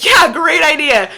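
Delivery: retold sarcasm; sarcastic. Sarcastic reply to dad.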